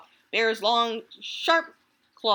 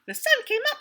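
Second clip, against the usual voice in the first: much higher, chirpy squirrel voice; chirped; faster than usual. Acted. Happy squirrel chirping at sunrise, very high. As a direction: chirp it like a tiny excited squirrel. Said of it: "he chirped".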